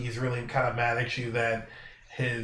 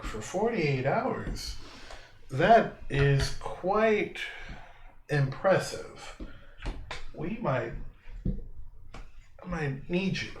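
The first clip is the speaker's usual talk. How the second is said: slow, higher, weighing each word; impressed game character; 4 semitones higher, 3 dB quieter, much slower than usual. Acted. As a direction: draw it out as if appraising a newcomer. Game master voices impressed character.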